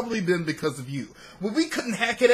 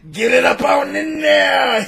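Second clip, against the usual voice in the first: shout; 6 semitones higher, 7 dB louder than usual. Acted. Crude joke shouted.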